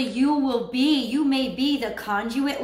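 ordinary talk, nothing marked

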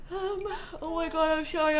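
talking through a yawn; mid-yawn words. Real, not acted. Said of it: "I'm yawning again".

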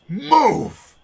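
high booming shout; villain blasting a player with a command, shouting. Acted. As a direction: bellow the order at them. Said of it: "he turns to you and goes".

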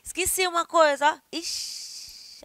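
high then hushed ixi; realizing you forgot something; forgetful ixi. Shown as a demonstration. Teacher demos forgetting, hushed ixi. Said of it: "Aí você bota a mão na cabeça".

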